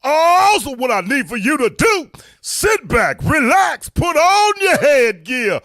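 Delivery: high, loud, drawn-out hype intro; hype show announcer. Acted. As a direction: holler the opening, stretching the big words. Show's hyped-up opening call.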